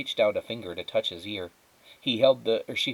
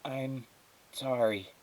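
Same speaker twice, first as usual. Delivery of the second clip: small voice; 4 semitones higher, 6 dB quieter, slower than usual. Acted. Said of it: "in a small voice".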